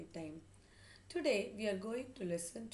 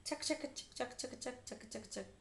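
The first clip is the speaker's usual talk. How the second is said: poking noises; 5 semitones higher than usual. A narrator's reading. Grandmother pokes with a rolled newspaper, poking noises. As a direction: rattle off quick staccato jabs.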